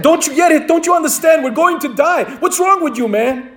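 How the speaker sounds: high, frantic shouting castaway. A narrator's reading. Castaway shouting at his calm companion, frantic. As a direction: yell in disbelief at his calm friend. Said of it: "drove the other guy crazy".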